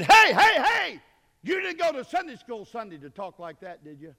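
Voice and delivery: high, loud call-out, easing off; shouted challenge. Retold or imitated. Brother Hand confronting a cussing man, shouted challenge. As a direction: holler to stop him short, then settle. Preacher acts out confronting cusser.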